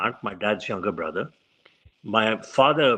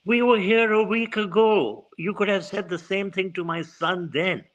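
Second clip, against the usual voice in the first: a mother's voice, raised high at first; voiced mother; 8 semitones higher than usual. Mother reminding Gandhi he could have said it last week, lightly voiced. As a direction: press Gandhi gently as the mother.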